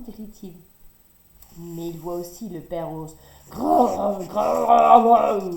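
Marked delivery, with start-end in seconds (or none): 3.5-5.5 loud growling bear; furious bear growl. A narrator's reading. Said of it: "gronde l'ours furieux". (grognement furieux de l'ours)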